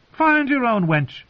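high, drawn-out defiance; singer's defiant retort. A narrator's reading. Singer to the armed stranger, high defiant retort. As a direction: send him off in a high voice.

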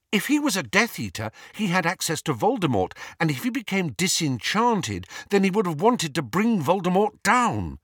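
high, eager reasoning; excited deduction. A narrator's reading. Hermione explaining her theory to Harry, excited.

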